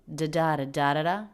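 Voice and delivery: "da da da da da da" with the fourth syllable strongest; stress rhythm on da. Shown as a demonstration. Teacher mapping the stress pattern with nonsense syllables. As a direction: tap out the word's rhythm in da syllables. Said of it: "primary stress is on the fourth syllable".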